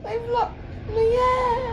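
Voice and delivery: high, slow wailing cry. Retold or imitated. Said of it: "why do you say it like I'm crying".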